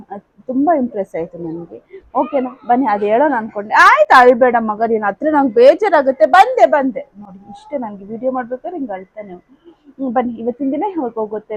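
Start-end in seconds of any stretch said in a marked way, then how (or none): 3.7-7.0 soothing crying son. Real, not acted.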